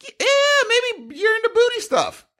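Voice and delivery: high teasing. Real, not acted. Teasing friend, then laughing.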